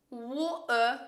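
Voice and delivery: British "water" with the T swallowed; imitated British accent. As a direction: mimic a child's British accent, stretching both syllables. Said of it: "She nailed the British accent".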